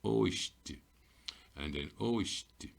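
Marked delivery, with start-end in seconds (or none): 0.0-0.8 oisht said clearly with a falling tune; blended syllable. Shown as a demonstration. Teacher blending the sounds for learners, clear and falling. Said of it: "put them together". 2.0-2.7 oisht drawn out slowly with a rise; slow blended syllable. Shown as a demonstration. Teacher repeating the blend slowly for learners. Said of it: "put them together".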